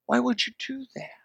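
mock astonished whisper. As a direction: gasp it out in disbelief. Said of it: "I go".

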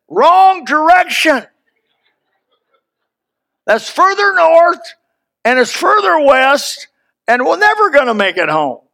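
high, slow, loud protest at the pilot; alarmed protest. Retold or imitated. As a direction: plead that it's the wrong way. Pilot diverting plane to Milwaukee.

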